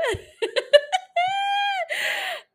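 long, very high squealing laugh; real cackle. Real, not acted. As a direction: crack up, high and drawn out. Cracks up at the drama.

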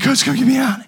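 high, loud plea; whimper-call for help, hammed-up; mock pleading. Hurt player begging coach from the sideline, mock pleading.

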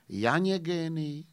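slow, weighty recital of the line; measured Russian-flavoured poem reading. Acted. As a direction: declaim it slowly like a poet. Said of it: "básní".

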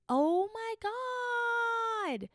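very high, hugely drawn out; stunned oh my God. Real, not acted. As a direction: hold the words out in disbelief. Reacting to the drug twist.

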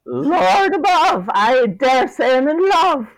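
high, slow swooning voice; smitten leprechaun. Acted. Bevan gazing at Phoebe, smitten and swooning. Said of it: "is enamored".

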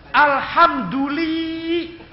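drawn-out, high-pitched alhamdulillah; alhamdulillah pamer. Retold or imitated. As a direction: stretch it out slowly in mock piety. Mengejek ucapan syukur yang pamer. (alhamdulillah pamer yang dipanjang-panjangkan)

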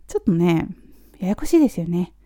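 usual speaking voice

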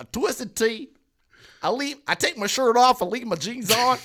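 loud, high mock country twang; country accent. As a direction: crow it in a hammed-up country accent. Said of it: "like, a country accent for it".

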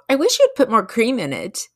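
mock demanding gripe. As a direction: demand it like an ungrateful guest. Imagined demanding complaint.

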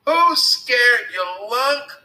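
high, loud retort; indignant call. A narrator's reading. Alan answering Billy's taunt, calling out indignantly. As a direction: call it back defiantly. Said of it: "called Alan".

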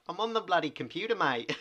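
loud, high mock Irish accent; hammed-up Irish lad at his computer. Mocking the film's hero in an Irish accent. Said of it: "Is that your Irish accent?".

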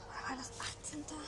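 whispered aside to herself; quick unvoiced whisper. Real, not acted.

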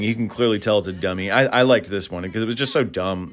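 ordinary delivery

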